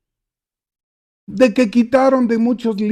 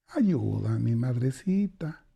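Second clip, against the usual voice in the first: soft plea; 5 semitones lower, 5 dB quieter, slower than usual. Acted. Man praying to the Virgin.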